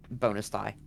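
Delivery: ordinary delivery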